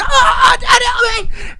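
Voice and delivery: very high, loud shriek for help; hammed-up child's screaming plea. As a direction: scream like a terrified kid. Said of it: "There's your kid screaming".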